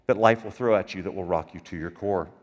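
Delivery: ordinary delivery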